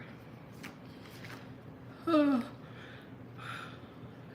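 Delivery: long unvoiced yawn; a big open yawn. Real, not acted. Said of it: "I don't know where I'm yawning from".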